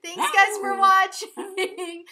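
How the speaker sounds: loud high laugh into slow sign-off; big laugh. Real, not acted. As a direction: laugh hard then drag out the thanks.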